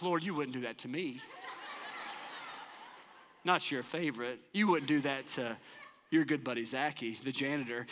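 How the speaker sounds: low, soft mock plea to God, half laughing; hushed pleading prayer. Janitor begging God to spare him, low hushed plea. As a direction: beg quietly, with a laugh under it.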